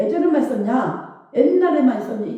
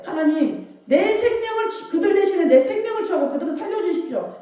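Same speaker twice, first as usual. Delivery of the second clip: higher, urgent retold intercession; Moses pleading; 6 semitones higher than usual. Moses interceding with God for sinful Israel, urgent plea. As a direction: plead quickly on others' behalf, pitch raised. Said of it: "이렇게 말했던 것처럼".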